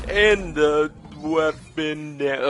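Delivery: dragged out, very slow; slow motion. Pretend. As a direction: stretch each word like a slowed-down replay. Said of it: "I should just talk in slow motion from now".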